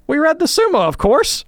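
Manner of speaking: high, loud mock-indignant answer; bemused indignation. Old priest answering about retreat, mock indignation. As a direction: imitate the old priest stating the obvious. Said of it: "bemused indignation, and he said".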